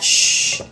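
long drawn-out shh; hushing. Retold or imitated. God silencing the speaker's doubts, hushing. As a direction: hush someone with a sustained shh.

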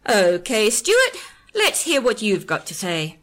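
loud, with a big rising leap on Stuart; defiant challenge. A narrator's reading. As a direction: dare him to speak, loudly. Marla challenges the tape.

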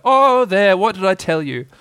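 high, loud start, easing down; fussing mother's reproach; motherly I-told-you-so. A narrator's reading. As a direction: tut at a child who didn't listen. Kanga tuts at child.

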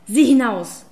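a loud, sharp hiss of an order; hissed command. A narrator's reading. Witch hisses at the man to look outside, loud and harsh. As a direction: spit the order out like a furious witch. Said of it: "fauchte sie".

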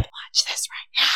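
urgent breathy whisper. Retold or imitated. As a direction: hiss it like a secret command. Urgent hushed demand, book fan.

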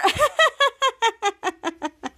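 long, high staccato fake laugh; a string of mock ha-has; imitated laughter. Two girls laughing at Volt, imitated high laugh. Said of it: "laughing at her".

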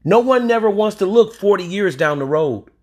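ordinary talk

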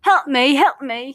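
loud mock cry for help; loud put-on "help me"; performed plea for help. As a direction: call out for help, playfully overdone. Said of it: "I didn't do it good".

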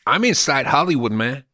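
mock Cuban accent. Comedian bragging to cohost about being inside Hollywood, in a mock Cuban accent. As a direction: play a fake Hollywood Cuban tough guy in a Cuban accent. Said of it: "I was using an accent".

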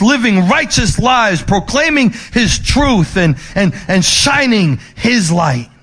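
rising, building sermon cadence; preaching build. Acted. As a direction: preach it with mounting fervor. Preaching climax.

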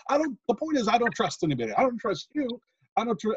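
a squeaky mock of the suspicious commentator; imitating Bobby Heenan. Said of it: "traditional Bobby Heenan's like".

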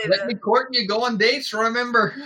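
high-pitched Scottish character voice; Scottish accent. A narrator's reading. He reminds his love to wait, in a Scottish accent. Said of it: "Stop with the accents".

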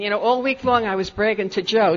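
normal talking voice

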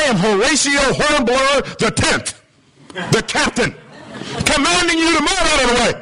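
high, self-important proclamation rising into a command; pompous captain. Acted. Captain pulling rank on a stubborn signal, pompous. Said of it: "Signal goes out again".

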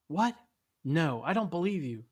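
high, loud disbelief; disbelieving. A narrator's reading. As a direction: challenge it loudly, refusing to believe. Guy challenges God in dream.

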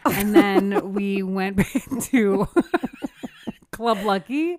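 laughing through the words; giggling while telling it. Real, not acted.

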